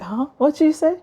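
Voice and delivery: lightly voiced dad who didn't catch it; puzzled dad. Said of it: "my dad will be like".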